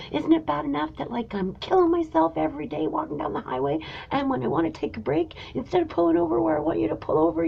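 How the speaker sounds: shrill exasperated tirade held down in volume; retold screaming rant. To her partner Chris in the motorhome, a high, hushed mock scream. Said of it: "I just started screaming at him".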